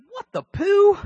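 high, loud squeaky inner outburst. Retold or imitated. Pastor voicing his jealous inner thought, high and loud. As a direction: blurt it out in a high cartoonish voice. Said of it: "On the inside, I'm saying".

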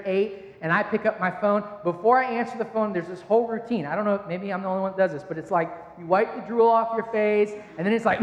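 ordinary talk, nothing marked

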